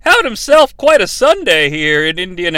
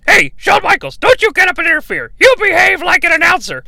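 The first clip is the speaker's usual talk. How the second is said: high, squawking heckler; excitable ringside commentator; 10 semitones higher than usual. Acted. Commentator taunting Shawn Michaels about big men, high-pitched heckling. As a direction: needle him in a high, quick voice.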